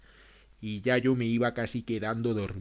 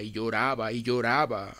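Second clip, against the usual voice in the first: ordinary delivery, nothing marked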